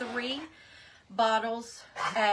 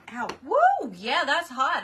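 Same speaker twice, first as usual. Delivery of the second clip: high, loud yelp of surprise at the heat; real startled outburst, loud and high; startled ouch; 5 semitones higher, 10 dB louder than usual. Real, not acted. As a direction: yelp out, burned by something hot. Touches hot glue underneath the lid.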